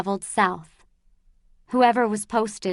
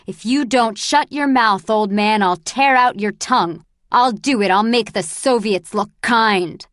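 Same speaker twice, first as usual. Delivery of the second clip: louder, menacing threat; furious threat; 6 dB louder than usual. Acted. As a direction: threaten him, seething. Andrus threatens the bald man.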